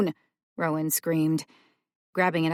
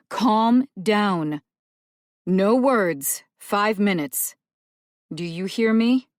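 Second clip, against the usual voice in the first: louder firm calming order; firm command; 3 semitones higher, 7 dB louder, slower than usual. Acted. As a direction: take control, steady him. Celia firmly steadies boy.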